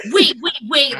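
re-enacted urgent warning. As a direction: hold back a crowd, half in play. Re-enacts crowd member holding pit.

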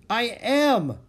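high, loud protest; exasperated retort. Acted. Annie stuck in mud answers Jack, exasperated retort. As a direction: snap back in exasperation.

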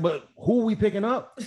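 high-pitched, incredulous question. Retold or imitated. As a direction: ask it like it makes no sense. Retells his baffled question to dispatch.

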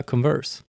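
"Converse" the Swedish way, stressed on VERSE; Swedish pronunciation. Shown as a demonstration. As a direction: say "Converse" as kon-VÖRS, like a Swede. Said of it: "gått tillbaka till att säga". ("Converse" med svenskt uttal, betoning på sista stavelsen)

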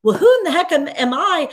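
high, loud puzzled exclaim; mock bewilderment. Professor recalling her own doubt, high loud bewilderment. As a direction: throw up your hands, who am I. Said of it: "I remember thinking".

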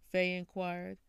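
ordinary delivery

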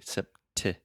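sept with a popping breathy T; aspirated T. Shown as a demonstration. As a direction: pop the t in sept. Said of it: "I'm really aspirating".

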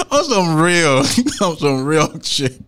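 normal talking voice

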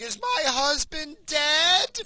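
very high, drawn-out wail of a distraught wife; loud high mock wail; retold crying outburst. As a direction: imitate her bursting out in a loud, panicked cry. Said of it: "she bursts out".

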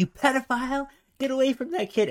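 high-pitched mock accusation; play-acted high shout; mock outraged yell. Imitating friend confronting VR strangers.

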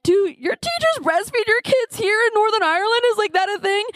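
high-pitched, mock-weepy questioning; retold tearful outburst. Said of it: "and I'm just crying".

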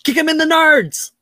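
high cheering yell; shout. Acted. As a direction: yell it from the crowd. Joking cheer at a fight.

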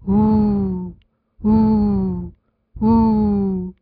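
storybook owl calling hoo; owl hooting. Acted. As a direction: hoot slowly like a wise owl. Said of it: "این صدای جغد دانا بود".

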